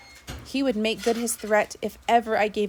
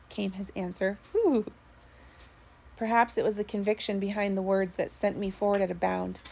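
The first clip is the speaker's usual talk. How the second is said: plain reading voice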